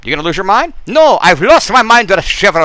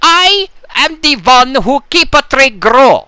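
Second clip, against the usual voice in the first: broken English character; 5 semitones higher than usual. Acted. Plays a tree-loving character.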